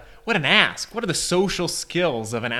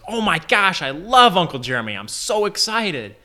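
put-on gushing kid, pitched up and eager; excited kid; 6 semitones higher, 3 dB louder than usual. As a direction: burst out like a thrilled young son. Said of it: "And Junior goes".

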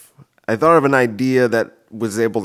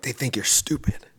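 softer, sneaky inner voice; voice of his brain; 4 semitones higher, 5 dB quieter than usual. Retold or imitated. His brain taunting him, a soft nagging voice. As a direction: whisper the doubt like a nagging brain. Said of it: "My brain will be like".

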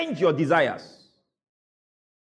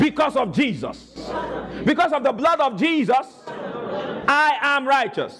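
higher, emphatic preacher's proclamation; raised-pitch call-out, stretched at the end; rallying declaration; 6 semitones higher than usual. Real, not acted. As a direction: proclaim it for the crowd to echo. Leading congregation in a declaration.

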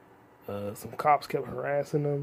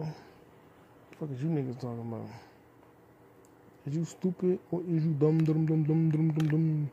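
higher, sing-song dumb dumb dumb; mocking taunt; 3 semitones higher than usual. Vlogger taunting the popup, higher sing-song mock. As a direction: taunt it like a playground bully.

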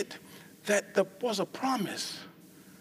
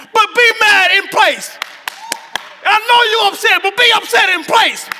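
higher, much louder shouted preaching; preaching at full force; 10 semitones higher, 13 dB louder, slower than usual. Acted. Preacher urging the angry to stay put, shouting. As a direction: shout it over the organ.

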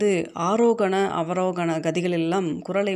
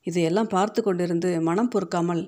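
usual speaking voice

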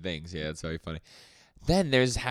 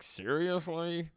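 incredulous; 8 semitones higher, 4 dB quieter, much slower than usual. Retold or imitated. Asha doubts Denji's praise.

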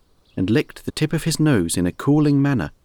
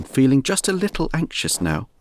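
usual speaking voice

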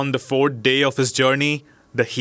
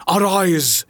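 higher, commanding genius cry; terrible voice; 6 semitones higher than usual. A narrator's reading. Enraged genius ordering the merchant to rise, terrible voice. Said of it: "he cried in a terrible voice".

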